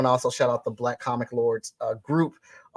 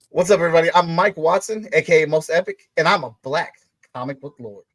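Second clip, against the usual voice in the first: loud, higher-pitched self-introduction; said with feeling; 5 semitones higher, 6 dB louder than usual. Pretend. Guest performing an initiation line for the host, with feeling. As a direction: announce yourself with feeling, loud and proud. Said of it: "say it with feeling".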